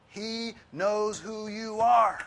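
high, loud, slow proclamation; booming emphasis. Real, not acted. Preacher booming to the congregation, slow and loud. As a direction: draw it out big and loud.

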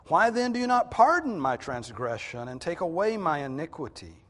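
impassioned prayer of complaint to God; dramatic scripture reading. Acted. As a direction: plead forcefully, building to loud protest then sinking away at the end. Reads Job's prayer aloud dramatically.

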